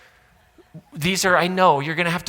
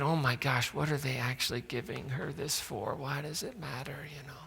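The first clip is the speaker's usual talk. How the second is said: softer, hushed muttering from the crowd; muttered gossip; 11 dB quieter than usual. Retold or imitated. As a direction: gossip under your breath, fading to a whisper. Onlookers muttering about the widow.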